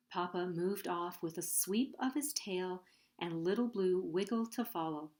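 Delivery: ordinary delivery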